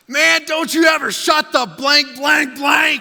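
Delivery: very high, full-volume outburst; furious shout. Retold or imitated. Student exploding at his friend before two thousand people, shouting. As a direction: lose it and yell across the auditorium. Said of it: "and I shout".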